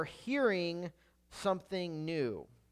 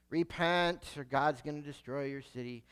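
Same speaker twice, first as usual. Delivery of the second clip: low, soft, listless warning; half-hearted, reluctant prophecy; Jonah; 5 semitones lower, 5 dB quieter than usual. Acted. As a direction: deliver the warning with no effort at all. Jonah repeats his reluctant message.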